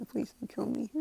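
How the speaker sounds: high, timid mock plea with nervous giggles; imitated scared tittering; nervously laughing. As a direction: giggle anxiously and beg in a tiny high voice. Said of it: "Kinda, like, nervously laughing".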